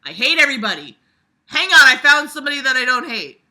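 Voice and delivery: loud, high mock whine; loud mocking impression; mocking parody. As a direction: shout the character's logic mockingly. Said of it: "That's his big fucking arc".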